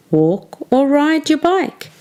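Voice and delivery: usual speaking voice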